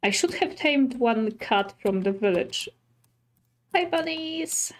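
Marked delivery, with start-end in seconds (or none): 3.7-4.7 high, soft coo to the animals; sweet talk to little bunnies. Real, not acted. Player greeting bunnies, high soft coo.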